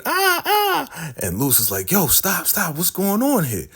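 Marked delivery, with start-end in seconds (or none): 0.0-1.1 very high comic squeal; hammed-up high-pitched "ah, ah"; mock scream. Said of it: "Bridget is screaming". 1.9-3.7 high-pitched urgent protest; mock alarm, pitched up; alarmed intervention. Acted man breaking up a fight.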